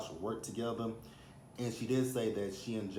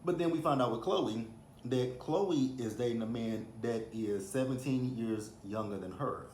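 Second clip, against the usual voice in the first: ordinary talk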